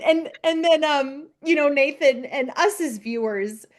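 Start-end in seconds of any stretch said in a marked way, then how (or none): none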